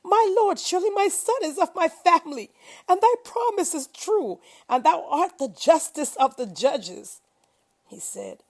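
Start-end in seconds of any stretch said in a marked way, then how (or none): 0.0-7.2 high, loud anguished cry to God. A narrator's reading. Noah cries out to his Lord for his drowned son, loud anguished plea. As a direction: plead with the Lord at full voice, heart breaking. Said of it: "Noah cried to his Lord".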